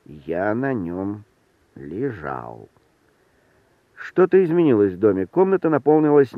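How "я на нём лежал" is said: very slow, low, fading off; spoken aloud, musing. A narrator's reading. Hero alone, recalling he lay on the sofa, slow low musing. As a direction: drag it out, sinking lower and softer. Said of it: "вслух сказал я".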